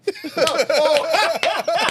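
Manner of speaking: loud high burst of laughter; real laughter; laughing. Real, not acted. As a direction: crack up. Laughing at the fan comment.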